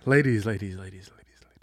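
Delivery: announcer voice fading like an echo. Pretend. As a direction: let each repeat die away. Echoing announcer bit.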